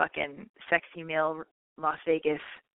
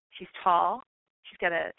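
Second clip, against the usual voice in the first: normal talking voice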